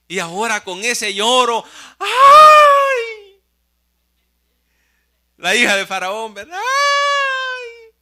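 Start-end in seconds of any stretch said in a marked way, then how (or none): 2.0-3.4 piercing, very high and loud delighted ay; high cry of delight. Acted. Daughter moved by crying baby. 6.6-7.9 piercing, very high and loud delighted ay; high cry of delight. Acted. Daughter moved by crying baby.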